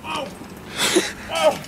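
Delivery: sledding downhill. Real, not acted. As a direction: shriek as you lose control. Crashing wagon down hill.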